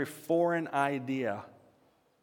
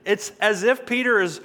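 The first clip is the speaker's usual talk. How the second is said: ordinary talk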